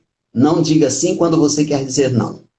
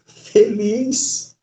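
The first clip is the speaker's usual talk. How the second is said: higher pitch; 8 semitones higher than usual. Shown as a demonstration. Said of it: "eu tenho que brigar".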